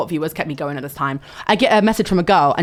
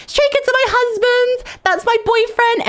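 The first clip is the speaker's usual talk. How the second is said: very high-pitched, slowed fangirl wail; shrieky mock-adoring declaration; fangirl squealing. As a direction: imitate an over-the-top fan's squeal. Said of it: "I'm going".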